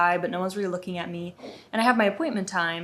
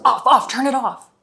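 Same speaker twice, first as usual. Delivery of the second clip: higher, much louder mock bark; retold barked order; 6 semitones higher, 11 dB louder than usual. Guard handed a visitor's phone, barking an order. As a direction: fire it out as an irate guard's command.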